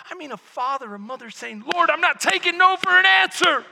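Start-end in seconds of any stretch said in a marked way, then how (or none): 1.7-3.7 loud, high, fervent shouted plea; pounding prayer. Acted. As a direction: cry out to God, refusing to give up. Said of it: "pounding on the gates of heaven".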